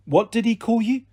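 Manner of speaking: usual speaking voice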